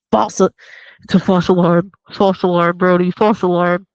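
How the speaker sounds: loud call across the beach; calling out false alarm. Retold or imitated. Beachgoers calling to Brody, loud call.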